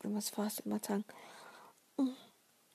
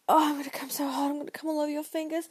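higher, louder, breathless rush; urgent climax cry; 5 semitones higher, 6 dB louder, faster than usual. Acted. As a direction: blurt it out on the edge. Acted climax, urgent cries.

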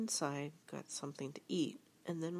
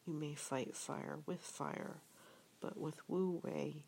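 ordinary delivery, nothing marked

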